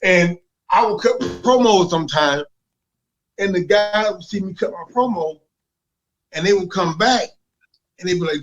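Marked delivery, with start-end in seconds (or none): none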